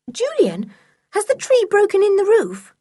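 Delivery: high, worried question; anxious. A narrator's reading. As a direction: ask it urgently in a high voice. Anxious question after the crash.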